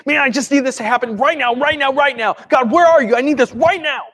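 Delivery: high, insistent panicked demand; freaking out. Acted. Desperate believer demanding God act now, freaking out. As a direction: cry out to God, frantic and impatient. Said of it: "you are just freaking out".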